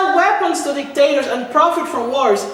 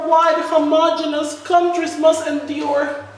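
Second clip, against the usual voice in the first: usual speaking voice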